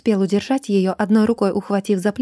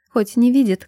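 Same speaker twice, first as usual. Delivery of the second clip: ordinary delivery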